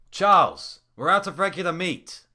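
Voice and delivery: high, loud shout across the kitchen; calling out. A narrator's reading. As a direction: yell it to the manager. Calling out to manager.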